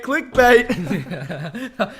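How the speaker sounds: laughing repeat with a rising end. Pretend. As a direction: laugh through the word clickbait.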